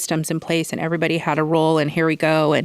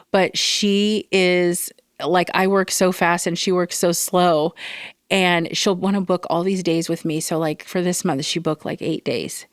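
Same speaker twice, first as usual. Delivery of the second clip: normal talking voice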